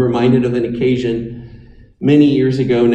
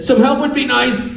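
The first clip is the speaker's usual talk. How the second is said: offhand, higher pitched, no urgency at all; casual understatement; 9 semitones higher than usual. Retold or imitated. John surfacing in the river, calmly asking friends for help. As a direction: toss it off like it's no big deal. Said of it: "when he bobbed to the surface for a moment and said".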